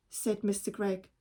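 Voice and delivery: usual speaking voice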